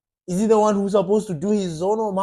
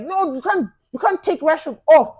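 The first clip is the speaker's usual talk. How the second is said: mock panicked fan; 6 semitones higher, 5 dB louder than usual. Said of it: "we're like".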